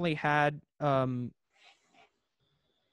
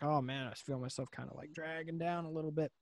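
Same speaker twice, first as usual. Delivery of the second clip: tired gym mutter; 12 dB quieter than usual. Retold or imitated. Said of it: "halfway through your workout".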